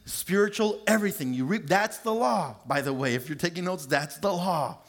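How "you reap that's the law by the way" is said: ordinary talk, nothing marked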